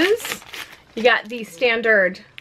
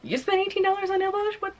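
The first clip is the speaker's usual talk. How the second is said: mock disbelief; 8 semitones higher, 6 dB quieter, faster than usual. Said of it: "looked them up and went".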